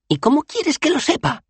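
very high, indignant protest; defensive. A narrator's reading. Dos Flores answers Bethan about his luggage, defensive and high-pitched. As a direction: protest it as if wrongly blamed. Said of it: "se defendió Dos Flores".

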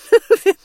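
sudden high, loud burst of laughter; warden's loud laugh. A narrator's reading. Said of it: "laughed the warden".

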